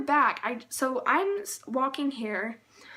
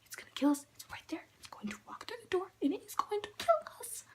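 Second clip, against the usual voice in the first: hushed, urgent whispering; acted terrified whisper of inner thoughts; frightened whisper; 8 semitones higher, 7 dB quieter, faster than usual. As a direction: whisper it like you're hiding from danger. Retold fearful thoughts inside the house.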